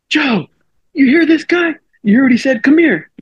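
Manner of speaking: very high-pitched mock call; imitated urgent call. Teammate calling the pitcher out to hear a heckler, mock urgency. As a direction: pitch it way up, urging someone over.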